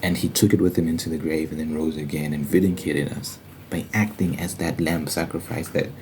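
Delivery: ordinary talk, nothing marked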